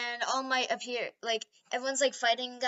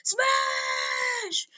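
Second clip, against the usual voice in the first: attack yell; 8 semitones higher, much slower than usual. Acted. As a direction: roar the attack name as you punch. Hero attack call.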